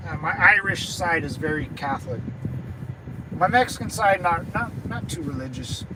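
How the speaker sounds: ordinary talk, nothing marked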